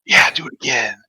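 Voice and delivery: whispered, voiceless urging; secret whispered message. Acted. Player sends a magical message to a comrade, whispered. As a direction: egg them on in a whisper. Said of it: "holding my action with message".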